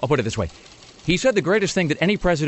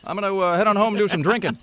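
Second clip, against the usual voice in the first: much higher, lonely presidential speech voice; hammed-up Obama rally to an empty room; mock Obama in an empty hall; 10 semitones higher than usual. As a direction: play Obama addressing a hall nobody came to. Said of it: "I'm picturing just a big sort of echoing hall".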